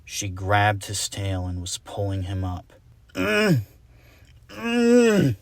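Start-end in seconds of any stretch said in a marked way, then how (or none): 3.1-5.4 high loud effortful hums; straining grunts. Acted. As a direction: heave with all your strength. Unicorn straining to pull.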